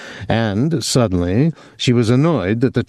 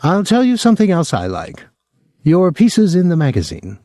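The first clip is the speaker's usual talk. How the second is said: effusive party guest; 8 semitones higher than usual. A narrator's reading. Voices Lister, the party guest.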